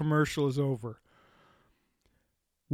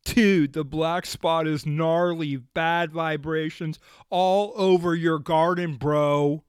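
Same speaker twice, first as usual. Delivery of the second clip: lightly voiced laid-back surfer dude; a hint of a California surfer drawl; mock surfer dude. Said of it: "so how about surfers?".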